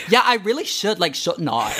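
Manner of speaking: high, loud mock Australian accent. Agreeing to complain, in thick mock Australian accent. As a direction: ham up an eager Aussie voice. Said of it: "these intense fucking Australian accents".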